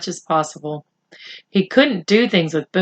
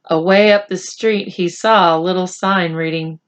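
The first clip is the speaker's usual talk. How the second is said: plain reading voice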